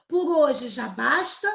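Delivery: usual speaking voice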